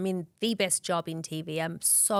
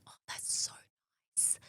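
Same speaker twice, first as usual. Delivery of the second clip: breathy hushed whisper; 14 dB quieter than usual. Retold or imitated. Recalling her naive thanks to the clinic, whispered. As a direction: say it almost soundlessly.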